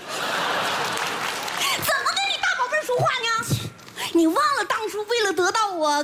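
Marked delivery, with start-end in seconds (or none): 0.1-3.3 slow, high cutesy whine; mock pout. Wife scolding husband in cutesy mock pout. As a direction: pout at him like a spoiled sweetheart.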